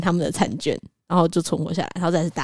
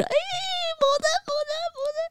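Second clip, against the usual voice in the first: excited calling; 6 dB quieter, much faster than usual. Retold or imitated. Organizer friends calling her name.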